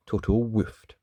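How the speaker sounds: ordinary delivery, nothing marked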